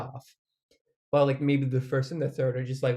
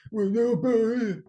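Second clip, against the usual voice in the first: SpongeBob quote; 9 semitones higher, slower than usual. Retold or imitated. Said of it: "it's like the SpongeBob meme".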